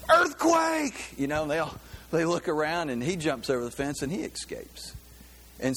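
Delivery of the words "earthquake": high, loud cry of "Earthquake!"; yelled warning. Retold or imitated. Student yells at the firing squad to escape, loud and high. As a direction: shout it like the ground is shaking. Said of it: "And so he yells".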